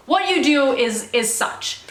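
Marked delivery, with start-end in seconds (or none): none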